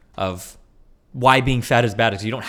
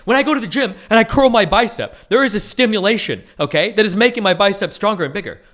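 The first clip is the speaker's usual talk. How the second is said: high, loud over-explaining; exasperated explanation; 9 semitones higher, 7 dB louder than usual. Retold or imitated. Imagined debate, loudly over-explaining his point. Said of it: "I'm like".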